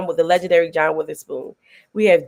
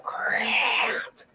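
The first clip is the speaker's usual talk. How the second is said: whispered complaint; 3 semitones higher, 9 dB quieter than usual. Retold or imitated. Mom quoted groaning about cramps.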